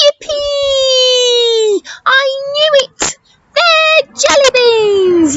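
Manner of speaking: high, thrilled and bursting with joy; excited squeal. Acted. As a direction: squeal it like a delighted kid. Child character excited finding jelly beans.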